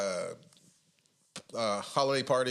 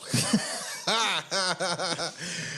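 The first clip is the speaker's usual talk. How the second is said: laugh; 6 semitones higher, 3 dB louder than usual. Real, not acted. Laughing at the Nambla joke, real laughter.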